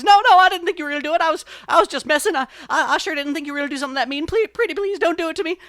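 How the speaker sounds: high, loud, fast begging; frantic pleading. A narrator's reading. Brer Rabbit begs the fox for mercy, frantic mock pleading. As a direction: beg in a hammed-up panic.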